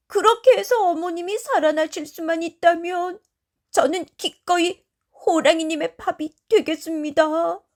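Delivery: high-pitched, fervent plea; earnest pleading. A narrator's reading. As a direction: beg the tiger with all your heart. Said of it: "아주 간곡하게 말이야". (높은 목소리로 간곡하게 애원)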